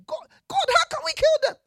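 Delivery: very high, loud pleading cry; mock pleading people. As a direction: cry out like people begging to spare the cows. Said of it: "these people did".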